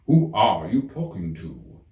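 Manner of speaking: peer at the strange head and ask; curious King. A narrator's reading. King asks Alice about the cat's head, slow and curious. King's voice.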